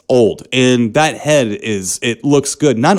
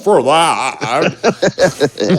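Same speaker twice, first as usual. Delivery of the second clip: high, drawn-out comic warning; mock-alarmed old man; 7 semitones higher, much slower than usual. Old man warning his wife about stealing oranges, mock alarm. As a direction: stretch it out in exaggerated high alarm.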